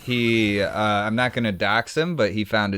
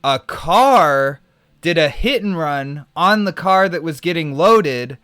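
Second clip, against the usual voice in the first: rising higher and more worked up as the accident unfolds; building excitement; 7 semitones higher, 4 dB louder than usual. Retold or imitated. Streamer retelling the tow driver's accident story, building excitement.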